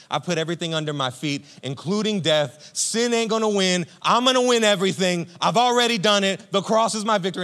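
loud, high victorious king declaring his win; triumphant Jesus. Acted. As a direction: crow over death like a conquering king. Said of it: "Jesus resurrecting and being like".

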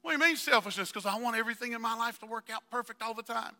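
whiny selfish believer. Retold or imitated. Pastor mimics a selfish churchgoer, whiny. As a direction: play the spoiled believer wanting it all.

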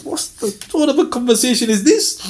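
high baffled aside; mock bewildered reader; amused bafflement. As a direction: pitch up in comic wonder. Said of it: "I'm thinking".

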